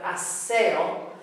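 "aseo" slowly, stressed on SE; slow, careful "aseo" with a stressed middle syllable; beat on E. Shown as a demonstration. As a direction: draw out a-SE-o with weight on the e. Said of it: "the beat is on the e".

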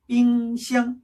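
"삥샹" as two held level notes; double first tone. Shown as a demonstration. Teacher modelling fridge in Chinese, "삥샹" both syllables level. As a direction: keep both "삥" and "샹" equally high and unwavering. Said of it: "이렇게 되고요".

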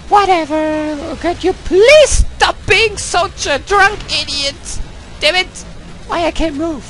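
put-on a falsetto girl persona ranting in English at the game. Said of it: "I'm playing the English girl right now".

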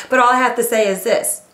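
ordinary delivery

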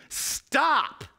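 high, sharp cry of stop; urgent yell, pitch jumping way up; screamed warning. Acted. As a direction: scream it to halt a friend headed for a cliff. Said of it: "You are going to scream".

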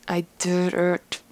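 slow, soft goofy drawl; silly drawl. Acted. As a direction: stretch the vowels out softly, goofily distorted. Repeats line in silly drawl.

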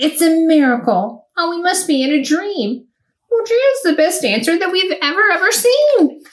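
mild excited exclaiming, rising high at the end; lightly voiced amazed crowd; shouting in wonder. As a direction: cheer like you can't believe it, but gently. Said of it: "They shouted".

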